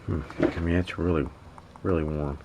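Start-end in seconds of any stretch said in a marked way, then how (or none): none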